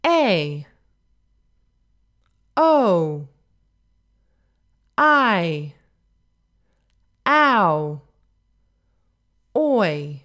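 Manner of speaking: "A, O, I, ow, oi" each gliding and falling; isolated moving vowels for learners to copy; diphthong drill. Shown as a demonstration. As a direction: glide through each diphthong, long pause between. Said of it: "you can repeat after me to practice these".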